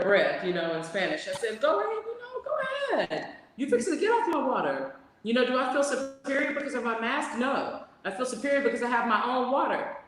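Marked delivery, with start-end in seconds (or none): 1.6-10.0 high, sassy telling-off; sassy high retort to a rude shopper; sassy high mock scolding. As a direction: put her in her place, sassy and mocking. Telling off the woman.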